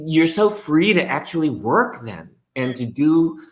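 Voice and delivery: ordinary talk, nothing marked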